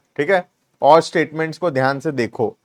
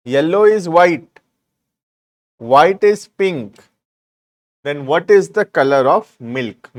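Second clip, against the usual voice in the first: slow, deliberate dictation to students; teacher dictating word by word; much slower than usual. Shown as a demonstration. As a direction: read each clause out slowly for notes. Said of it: "dictating the question slowly".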